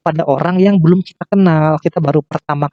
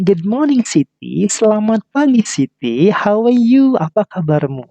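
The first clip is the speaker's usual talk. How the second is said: mother greeting; 6 semitones higher, slower than usual. Acted. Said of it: "si ibunya mengatakan".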